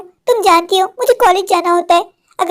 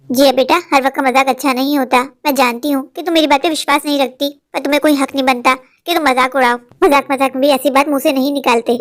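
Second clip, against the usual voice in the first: lower, grave rebuke; lower, stern mother telling her daughter off; stern scolding; 6 semitones lower than usual. Acted.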